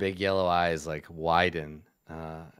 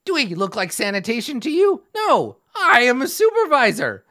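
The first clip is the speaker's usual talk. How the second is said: high, loud goblin supervisor; a huffy goblin boss; indignant goblin; 13 semitones higher, 7 dB louder than usual. Retold or imitated. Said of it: "and they're like".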